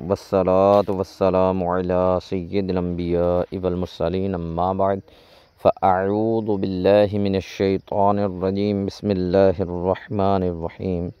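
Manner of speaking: brisk, soft Arabic opening prayer recited by rote; quick recited invocation. Real, not acted. Teacher opening the class with a quick, soft recited prayer. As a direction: rattle off the opening blessing quietly and quickly.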